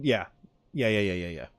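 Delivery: ordinary delivery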